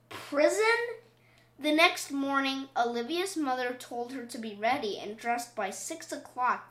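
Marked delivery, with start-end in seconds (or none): 0.1-1.0 much higher, alarmed Prison; shocked question. Acted. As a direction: gasp out the word in horror. Olivia shocked at the word.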